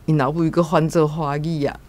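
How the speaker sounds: usual speaking voice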